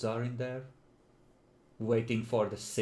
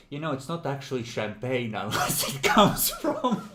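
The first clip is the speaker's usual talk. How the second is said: snooty know-it-all, a bit louder; 3 semitones higher, 5 dB louder than usual. Acted. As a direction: say it like a smug wine snob. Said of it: "quoting a fact in a snooty voice".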